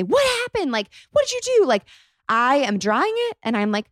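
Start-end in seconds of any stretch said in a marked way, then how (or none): 0.0-1.7 mock hysterical bride. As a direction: play the bride who loses it, squeaky and half-joking. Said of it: "start crying and start screaming and say".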